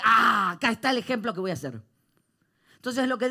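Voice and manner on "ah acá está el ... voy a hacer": excited eureka. Retold or imitated. Retells his own eureka moment.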